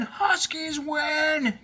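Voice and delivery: high, very slow shouted Huskies win; stretched shout. A narrator's reading. The team shouting the win a second time, stretched shout. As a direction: hold the win out long and shouted. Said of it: "cheered the team".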